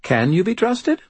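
put-on high-pitched, playful child's question; little girl asking with whimsy; whimsical. A narrator's reading. Girl quizzes her bodyguard, whimsical. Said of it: "her voice whimsical".

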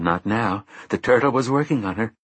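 plain reading voice

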